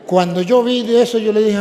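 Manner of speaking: normal talking voice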